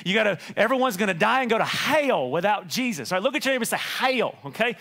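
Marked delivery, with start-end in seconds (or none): 0.6-3.1 mock hellfire preaching, "hell" as two syllables; angry, super-holy preacher. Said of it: "put an extra syllable if you're really angry". 3.8-4.3 "Hell" stretched into two syllables, hay-ul; mock-holy two-syllable "Hell"; extra syllable. Shown as a demonstration. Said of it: "you gotta put an extra syllable".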